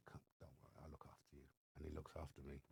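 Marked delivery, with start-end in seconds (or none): 0.1-1.4 soft whispered promise of protection; hushed reassurance. Retold or imitated. The lifer reassuring the frightened new inmate, whispered. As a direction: whisper it close, calming a scared newcomer. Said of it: "he just went like that".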